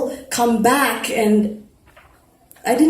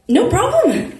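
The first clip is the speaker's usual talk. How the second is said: higher, bright cheerful reply; 13 semitones higher than usual. A narrator's reading. Hannah brushes off Quinn's thanks, higher and cheerful. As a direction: toss it off cheerfully. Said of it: "I said cheerfully".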